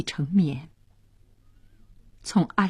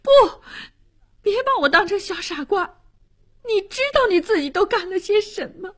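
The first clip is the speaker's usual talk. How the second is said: high-pitched, wounded indignation; bitter accusation; 10 semitones higher than usual. A narrator's reading. Niece accuses her aunt of scheming, bitter and high.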